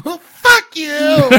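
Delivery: high, drawn-out pretend wail; hammed-up tearful outburst; mock crying. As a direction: wail it in defiance, half crying. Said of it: "I'll be crying and going".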